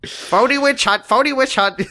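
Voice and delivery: high, squawking parrot demand; silly high bird squawk; talking bird. Acted. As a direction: repeat it like a trained cockatoo. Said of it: "most birds are like".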